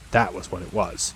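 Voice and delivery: ordinary delivery, nothing marked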